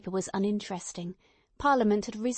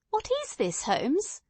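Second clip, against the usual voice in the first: higher, startled and incredulous outburst; astonished cry; 9 semitones higher, slower than usual. Acted. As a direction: cry out in blank amazement. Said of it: "I cried".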